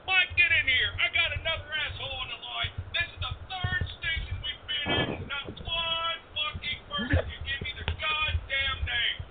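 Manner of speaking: high, furious ranting; angry rant. Acted. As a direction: rant in total frustration. DJ rants at staff.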